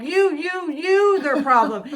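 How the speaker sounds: high, loud, insistent accusation; accusing outburst. Retold or imitated. Driver blaming the cop, accusing. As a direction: jab each repeated word at them.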